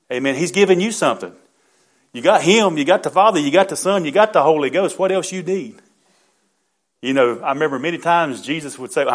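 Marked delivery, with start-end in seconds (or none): 0.3-5.8 louder rousing preaching, pitched up; full-force revival preacher cadence. Acted. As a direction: drive each "you got" home to the congregation. Preacher building to a climax.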